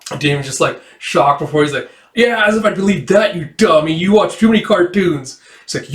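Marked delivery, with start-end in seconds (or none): 2.1-5.4 raised-pitch dismissive jeer; mock scoffing. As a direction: jeer like a kid who won't believe it. Acting scoffing comeback in squabble.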